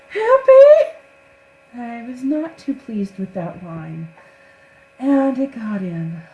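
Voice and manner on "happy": very high, loud shout of Happy; over-the-top high yelp; loud squeaky Happy. Retold or imitated. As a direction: blurt it out shrill and high. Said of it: "especially when she goes".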